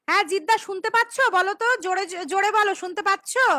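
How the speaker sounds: loud, quick calling out; to someone hard of hearing. Real, not acted. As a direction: call it out across the room. Asking listener to answer loudly.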